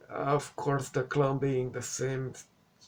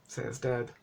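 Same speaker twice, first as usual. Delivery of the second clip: usual speaking voice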